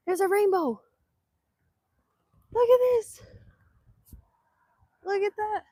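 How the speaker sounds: high, loud and thrilled; shrieking with delight; excited. Real, not acted. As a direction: burst out at the rainbow. Spotting the rainbow.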